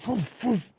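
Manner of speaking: high barked woof woof; mock woof. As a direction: bark it playfully. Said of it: "it's like".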